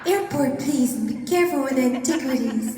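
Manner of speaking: hammed-up posh blonde voice; blonde accent. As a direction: play a glamorous blonde giving orders to a driver. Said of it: "I need to do a blonde accent".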